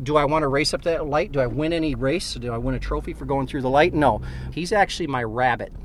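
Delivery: ordinary talk, nothing marked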